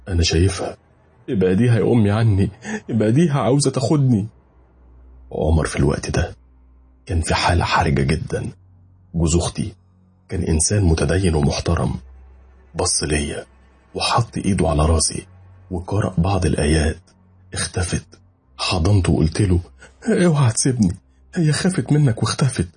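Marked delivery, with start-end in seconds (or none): 1.3-4.3 high, panicked begging; terrified plea. Retold or imitated. Begging mother to save him. 20.1-22.7 high, frightened pleading; clinging to his brother-in-law, scared and pleading; desperate plea. Retold or imitated.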